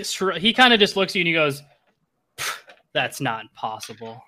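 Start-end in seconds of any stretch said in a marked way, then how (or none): 2.4-4.2 slow, low, softer scoff; dismissive disbelief. Acted. Game master as the enemy shrugging off an insult, dismissive. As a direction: scoff it off slowly under a puff of air.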